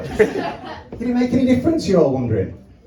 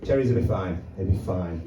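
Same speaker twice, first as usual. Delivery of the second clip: low calm vet; 13 semitones lower, 6 dB quieter than usual. Retold or imitated. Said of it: "Vet's like that".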